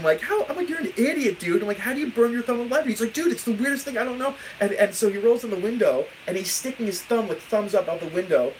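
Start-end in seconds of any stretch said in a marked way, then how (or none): 0.2-2.9 high, quick mock scolding; retold berating. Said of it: "like, berating him". 3.1-4.3 high-pitched imitated friend; baffled protest. Friend's baffled defence acted out.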